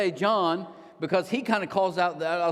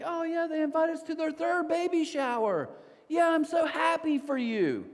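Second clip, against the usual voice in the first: sarcastic fake joy; 11 semitones higher than usual. Mock congratulating at baby shower.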